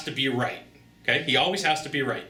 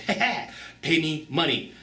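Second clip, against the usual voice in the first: gloating rival. Retold or imitated. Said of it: "come in and go".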